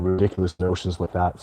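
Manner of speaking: ordinary delivery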